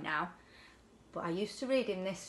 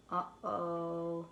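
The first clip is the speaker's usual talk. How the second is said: hushed uh-oh; 3 semitones lower, 7 dB quieter than usual. A narrator's reading. Fish swims into dark cave.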